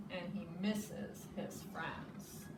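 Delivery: plain reading voice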